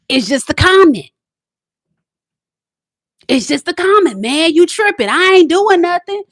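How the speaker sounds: put-on dismissive boyfriend. Boyfriend waving off her complaint, lightly voiced. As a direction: shrug it off like he's innocent. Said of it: "And he be like".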